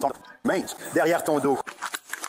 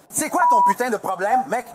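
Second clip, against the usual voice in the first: loud, raised, confrontational yell; angry shout; 5 semitones higher, 4 dB louder than usual. Real, not acted. Man confronts the officer cuffing him, angry shout. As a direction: snap back at the cop in anger.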